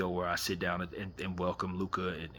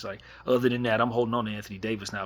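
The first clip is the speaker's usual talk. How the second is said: ordinary delivery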